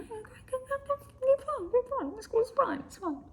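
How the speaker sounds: high strained fake cheer; mock falsetto. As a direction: squeak out a forced it's fine. Mock cheerful reply to friend.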